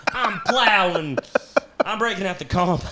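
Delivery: milk each boast with a laugh; swaggering boast. Acted. Husband boasting about tomorrow night's effort, swaggering.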